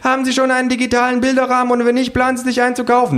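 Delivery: very high, fast question; hounding sociologist. Acted. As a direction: rattle off the survey in a high voice. Sociologists joining the chase.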